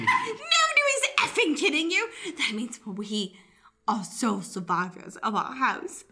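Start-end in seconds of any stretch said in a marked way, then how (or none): 0.1-2.1 laughing retort. Acted.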